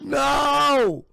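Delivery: high, loud drawn-out cry; long, high-pitched shout of protest. Real, not acted. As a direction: cry out in comic horror. Horrified shout at the crude joke.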